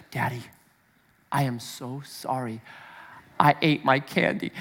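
dad voicing his daughter's apology; sorry little girl. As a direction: say it meekly, like a caught four-year-old.

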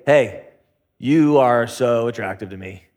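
low, smooth pick-up line; flirty come-on. Acted. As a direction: lay on the charm in a low voice. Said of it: "how I start every conversation".

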